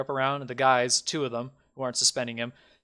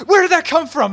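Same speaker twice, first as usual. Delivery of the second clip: high, loud panicked question; alarmed bandit; 11 dB louder than usual. Acted. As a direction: ask it in a panic. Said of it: "they go like".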